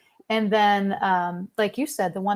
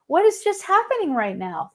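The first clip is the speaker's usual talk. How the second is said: amazed; 8 semitones higher than usual. Retold or imitated. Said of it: "And I'm like".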